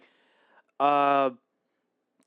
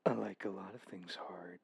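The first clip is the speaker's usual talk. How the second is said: whisper; 8 semitones lower, 16 dB quieter than usual. Real, not acted. Innuendo after a laugh.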